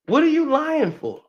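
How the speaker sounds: ordinary delivery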